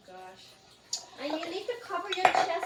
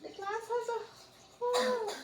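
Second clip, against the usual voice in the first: surprised; 9 semitones higher, 4 dB quieter than usual. Real, not acted. Glass leaking.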